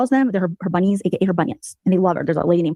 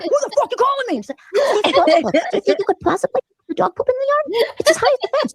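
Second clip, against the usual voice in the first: imitating a husband yelling on speakerphone; mock rude husband; 14 semitones higher than usual. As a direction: bark back like a crude annoyed man. Voicing husband answering rudely.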